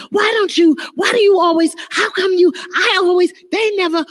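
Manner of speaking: high mock griping; complaining. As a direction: nag like a chronic complainer. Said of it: "Or complaining".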